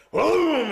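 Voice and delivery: high, loud "boom" for a crash; imitated crash. Telling how the chair hit the wall, imitated crash. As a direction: belt out the impact like a sound effect.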